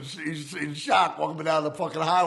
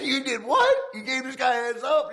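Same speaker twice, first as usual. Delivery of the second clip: high, loud, incredulous "you did what"; can't believe the friend tipped him off; disbelieving outrage; 12 semitones higher, 5 dB louder than usual. Retold or imitated. To his friend, shocked he warned the guy off.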